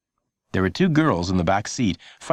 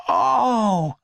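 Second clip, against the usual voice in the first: high surprised oh with a gasp; a little girl shocked by the wound; sharp child gasp; startled gasp; 12 semitones higher than usual. A narrator's reading.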